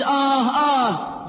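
long, pained groans of a dying man; drawn-out groans. Retold or imitated. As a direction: stretch each groan out in agony.

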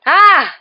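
short high, loud cry; startled scream. A narrator's reading. Ron wakes the dormitory in terror, loud scream. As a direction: scream out of a nightmare.

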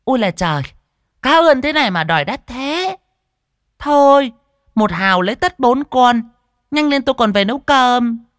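very high, loud market-woman haggling; a sharp fishwife sneering at the fish; high, loud scolding old woman; shrill shrewish voice. Acted. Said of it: "Cái giọng đanh đá vang lên".

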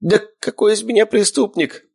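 high-pitched wimpy objection; babbled protest. A narrator's reading. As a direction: protest in a high, weak-man's voice. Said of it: "забормотал Щуплый".

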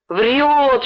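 one heckler calling from a crowd; crowd shout. A narrator's reading. Crowd disbelieves the boy, accusing shout. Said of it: "шумела толпа".

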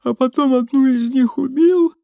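lightly voiced Molly. A narrator's reading. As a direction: pitch it well up for Molly's storytelling. Молли пересказывает историю, засыпая.

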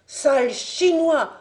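loud, high furious cry; yelling insult. Acted. Fabien hurling abuse at his captors, furious shout. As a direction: hurl the insult at the jailers. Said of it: "hurlait-il".

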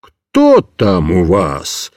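deep, slow booming question; thundering gendarme. A narrator's reading. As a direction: boom like a gruff sergeant. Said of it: "загремел жандарм".

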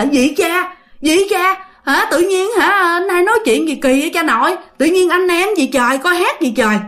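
high-pitched, incredulous challenge; indignant disbelief. Acted. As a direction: act out a baffled girl pushing back. Startled girl challenges sweet-talking man. (giọng ngạc nhiên, khó chịu, lên cao)

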